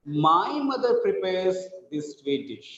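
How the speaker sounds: slow, with "My" drawn out and emphasized; stress on MY. Shown as a demonstration. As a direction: lean hard on "My". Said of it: "not somebody's".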